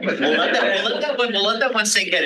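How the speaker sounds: high laughing words. Real, not acted. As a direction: laugh through an awkward moment. Audience silent after awkward question.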